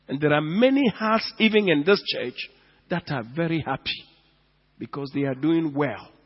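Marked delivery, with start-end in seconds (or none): none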